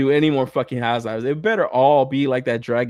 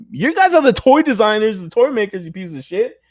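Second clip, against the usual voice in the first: louder and higher, really venting at the designers; angry rant; 8 semitones higher, 5 dB louder than usual. Real, not acted. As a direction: yell at the toy makers. Ranting at the toy designers.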